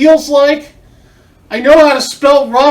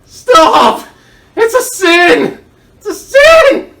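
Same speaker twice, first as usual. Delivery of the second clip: panicked screaming; 8 semitones higher, 6 dB louder, much slower than usual. Acted. Taped captive panics.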